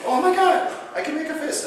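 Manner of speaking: high-pitched astonished exclamation, imitating; amazed. Patient finding he can make a fist, amazed. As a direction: act out a patient's amazed outburst.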